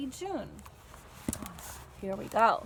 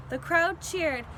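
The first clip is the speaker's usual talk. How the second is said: plain reading voice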